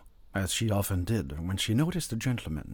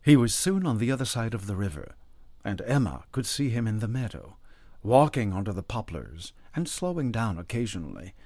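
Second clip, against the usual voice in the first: plain reading voice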